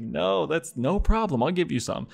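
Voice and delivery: mock agreeable. Host dodging his guest's ploy, falsely obliging. Overly agreeable reply covering dread.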